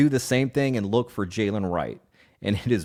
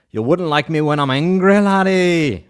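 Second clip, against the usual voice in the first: louder, higher mock Irish Hulk line; hammed-up Irish accent, a bit louder; 6 semitones higher, 6 dB louder than usual. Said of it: "if you do an Irish accent".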